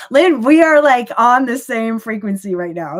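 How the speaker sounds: sudden high, loud delighted outburst; excited burst. Real, not acted. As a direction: burst out thrilled at the viewer's comment. Delighted at Lynn's comment.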